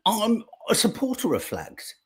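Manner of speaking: a lightly voiced parody of the Labour leader; parroting his flag slogan back with a laugh; mock politician. Host mocking the Labour leader's flag answer, lightly voiced parody.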